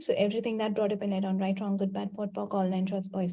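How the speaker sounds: quick, quiet recited clearing formula; rote clearing statement. Acted.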